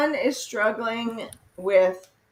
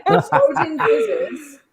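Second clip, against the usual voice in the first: laughing; 7 semitones higher, 6 dB louder, slower than usual. Real, not acted. Laughing recounting puking.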